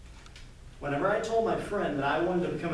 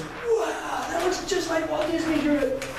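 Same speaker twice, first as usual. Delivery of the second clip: put-on high, wide-eyed kid amazement; awed child voice, higher; amazed wow; 9 semitones higher, slower than usual. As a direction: gasp at the drawing like a stunned classmate. Friend amazed by drawing.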